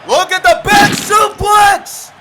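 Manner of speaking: loud, high excited match call; live play-by-play. Acted. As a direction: scream the big spots to the crowd.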